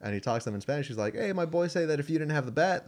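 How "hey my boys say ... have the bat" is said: higher-pitched retold dad pitch; calling over. Dad to the girls on behalf of his boys, calling over. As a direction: imitate a dad hailing strangers.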